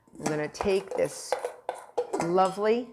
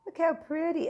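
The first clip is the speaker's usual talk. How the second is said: high cooing look how pretty; 9 semitones higher than usual. Pretend. Admiring the tart.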